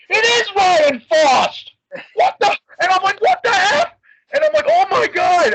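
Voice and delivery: high, loud shocked yelling; stunned high-pitched yell at spotting a favorite wrestler; shocked disbelief. Retold or imitated. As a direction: shout it like you can't believe who walked in.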